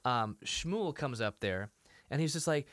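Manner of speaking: ordinary talk, nothing marked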